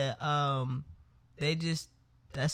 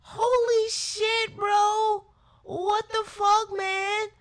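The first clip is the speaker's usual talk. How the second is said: high, loud words squeezed out through laughing; cry-laugh at your own dumb mistake; howling laughter; 9 dB louder, slower than usual. Real, not acted. Laughing at her own blunder, loud and high-pitched.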